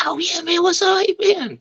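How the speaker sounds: mock buddy-buddy caller; imitating the other wrestler. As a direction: play him as overly friendly and oblivious. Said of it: "He's like".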